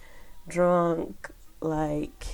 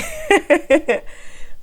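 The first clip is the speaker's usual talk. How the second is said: loud high laugh; 13 semitones higher, 10 dB louder than usual. Real, not acted. Calling out to her son.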